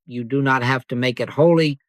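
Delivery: normal talking voice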